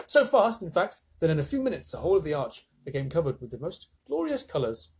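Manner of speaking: ordinary delivery, nothing marked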